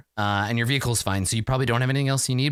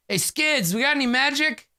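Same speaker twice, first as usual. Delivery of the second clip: higher, calling back to a coworker; calling over shoulder; 12 semitones higher than usual. Acted. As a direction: call out to Skids in the back room. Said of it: "He's like".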